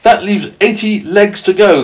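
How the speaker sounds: high, loud, slow centipede. Acted. Centipede teasing about boots left, high and loud. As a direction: call out the total high and drawn-out. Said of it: "the centipede said".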